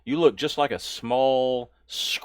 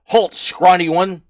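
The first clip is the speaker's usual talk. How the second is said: loud, high barked orc challenge; orc guard; 6 semitones higher, 10 dB louder than usual. Acted. Orc guard halting the small newcomer, loud bark. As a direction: bellow at the scrawny stranger like a guard. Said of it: "one of the orcs in Orcish says".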